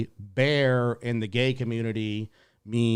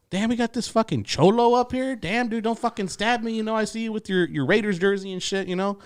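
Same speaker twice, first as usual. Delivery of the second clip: mock roast; 10 semitones higher than usual. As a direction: rib him like a comic on stage. Friend roasting on stage.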